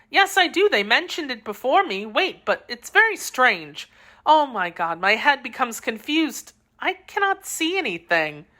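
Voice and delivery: high voice, losing her grip; a young woman's mind slipping; Constance growing confused. A narrator's reading. Constance, poisoned, tells the friends she cannot see. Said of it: "Constance grows confused, poisoned".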